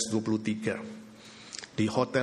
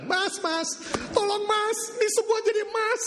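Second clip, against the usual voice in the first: much higher desperate cry; panicked pleading. Acted. Wife begging her husband to help, high panicked cry.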